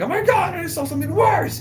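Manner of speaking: high-pitched mock panic; retold freak-out in a squeaky high voice; alarmed exclamation. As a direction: act out a frantic report, voice leaping up.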